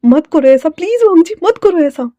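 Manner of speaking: high, loud desperate plea; urgent acted cry of alarm; panicked pleading. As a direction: beg them to stop, voice rising sharply. Fei stops him pulling the trigger.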